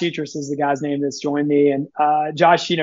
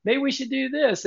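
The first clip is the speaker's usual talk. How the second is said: higher, faster, bubbly pitch of endless ideas; mock eager idea guy; 9 semitones higher than usual. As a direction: parody his boss blurting one idea after another. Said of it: "how many times you say".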